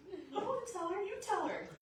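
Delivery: put-on high-pitched, protesting kid voice; reluctant daughter. Youngest daughter to dad about the broken glass, high reluctant protest.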